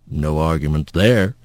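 low, unruffled rumble; calm rough voice. A narrator's reading. Mysterious voice agrees with raging Rat, low and calm. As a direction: agree calmly, deep down low. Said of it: "that voice said".

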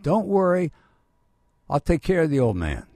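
retold shout to someone far down the dock; cocky holler over the water; yelling back. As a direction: call out loud and high across a distance. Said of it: "I yelled back at him".

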